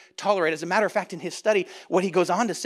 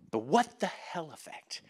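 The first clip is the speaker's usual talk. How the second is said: much softer, lingering on WHAT; hushed repeat; 4 semitones lower, 9 dB quieter than usual. Real, not acted. Preacher repeating the book's term, hushed for weight. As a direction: drop the volume and let it land.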